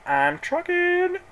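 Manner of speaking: high, slow sing-song I'm trucking; gleeful strut in the voice; jubilant. Retold or imitated. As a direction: crow it out triumphantly. Celebrating money back.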